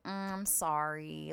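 soft, slow mock sympathy; mock pity. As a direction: imitate a pitying friend, soft and slow. Pitying look from others.

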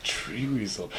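very soft, low murmur; hushed curse. Acted. Passing on the Tree Weasel curse, very hushed. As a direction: say it under your breath like a curse.

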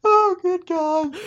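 high, slow oh good God through laughter; laughing groan. Real, not acted. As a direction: groan it while still laughing. Laughing fit.

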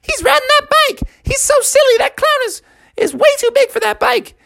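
put-on very high squeaky jeering kid; high-pitched kid crowing at a clown. Circus kids point at the silly clown, high kid voices.

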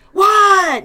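loud, high snap; mock snapping teen. Mother imitating her son snapping at her. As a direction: bark it back irritated. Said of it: "I'll be like".

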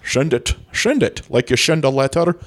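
"Send" pronounced "shend", slushy s. Pretend. Explaining "send" to a puzzled co-host, pronounced "shend". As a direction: turn the s of "send" into sh. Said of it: "What is a shend?".

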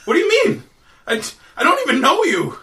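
high-pitched, panicked denial; alarmed protest. Acted. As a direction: protest innocence to an armed stranger. Accused stranger protests at knifepoint.

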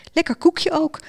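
put-on high-pitched, light girl's voice; nibbling girl. A narrator's reading. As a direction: chip in brightly over a biscuit. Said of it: "knabbelde het meisje".

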